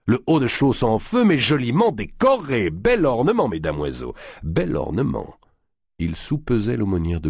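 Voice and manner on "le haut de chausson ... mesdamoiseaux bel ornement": mocking giant voice.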